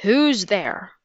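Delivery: understated shouted challenge in a captain's voice. A narrator's reading. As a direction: call out sternly, holding back the volume. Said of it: "the captain shouted again".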